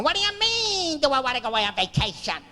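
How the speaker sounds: high-pitched indignant screech; screaming wife. Retold or imitated. Wife answering husband's vacation offer, screaming. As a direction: imitate a wife throwing the question back, outraged. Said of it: "She's screaming".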